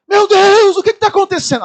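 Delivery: high, loud frightened cry; panicked cry. Retold or imitated. Woman at the front of the plane crying out in panic.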